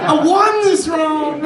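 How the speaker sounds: high, slow, laughing shout of victory; triumphant gloat. Retold or imitated. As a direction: crow over winning the round.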